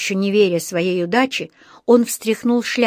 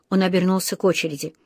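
plain reading voice

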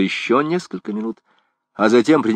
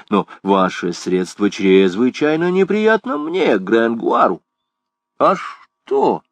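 higher-pitched, coaxing appeal; wheedling philosopher; 7 semitones higher than usual. Acted. Gringoire runs after his old friend the priest, coaxing. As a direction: talk him round with lilting politeness.

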